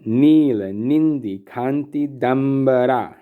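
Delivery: "Nila, Nindi, Kanti, Dambara" in measured long and short syllables; demonstrated cadence. Shown as a demonstration. Teacher going on to show the cadence, "Nila, Nindi, Kanti, Dambara" chanted rhythmically. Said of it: "the cadence of this word".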